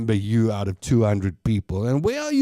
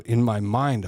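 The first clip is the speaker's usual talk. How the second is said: ordinary delivery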